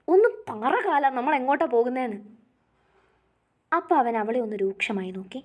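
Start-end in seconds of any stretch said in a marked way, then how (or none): 0.1-2.2 higher, faster, pressing question; wife presses her husband in the car, higher and urgent; insistent repeat. Acted. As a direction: push him for an answer. She repeats more insistently.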